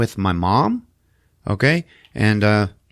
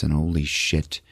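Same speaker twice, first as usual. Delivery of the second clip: usual speaking voice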